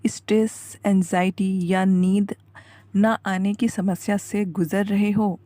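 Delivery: soft, measured guided-relaxation voice; hushed meditation intro. Acted. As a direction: gently draw a tired listener in, very softly. Opens guided meditation video with hushed teaser. (धीमी, शांत ध्यान वाली आवाज़)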